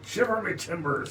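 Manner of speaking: high loud pirate cry. Pretend. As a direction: holler it like a pirate. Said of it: "Shiver me timbers is a great one".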